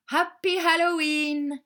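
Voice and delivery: high, loud, drawn-out cheer; excited holiday shout; festive call. A narrator's reading. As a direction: call out a Halloween greeting. Greeting called out in story.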